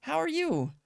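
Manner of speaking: high, sweet little voice for the cat; cute cat greeting. Retold or imitated. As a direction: greet like an adorable kitten. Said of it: "he was just like".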